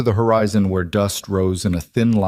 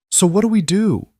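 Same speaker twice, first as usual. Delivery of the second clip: high-pitched, louder anxious question; worried plea, voice jumping high; edge of panic; 11 semitones higher, 3 dB louder than usual. A narrator's reading. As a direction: let panic push the pitch right up. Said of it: "the edge of panic in her own voice".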